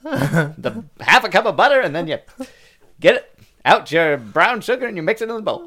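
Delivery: mock old Hollywood transatlantic voice; transatlantic accent. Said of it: "in a transatlantic accent".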